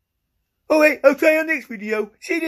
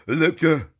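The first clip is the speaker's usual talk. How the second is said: much lower-pitched voice; different put-on voice; 8 semitones lower than usual. Shown as a demonstration. Trying Alexa in a different voice after being ignored, deep put-on voice. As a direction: drop the name into a deep register. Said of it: "Do it in a different voice".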